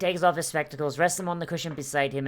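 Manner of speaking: ordinary talk, nothing marked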